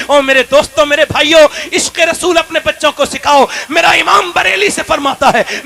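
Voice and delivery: shouted, high sermon call; preaching at full force. Acted. Preacher shouting to the gathered crowd, full-force preaching. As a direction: rouse the congregation like a fiery preacher.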